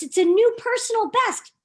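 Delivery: plain reading voice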